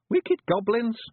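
high, rough little Scottish pictie, gently; a tiny Scots warrior breaking bad news; Feegle voice. Billy prompts.

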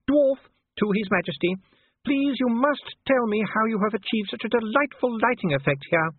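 very high, gushing duchess; trilling Sybil. A narrator's reading. Duchess smothering the dwarf in compliments, trilling. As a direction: take the correction and carry on trilling. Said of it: "she trilled".

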